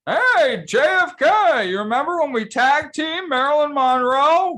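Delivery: high, loud gung-ho hooah shout; Al Pacino. Acted. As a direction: bellow it like Pacino.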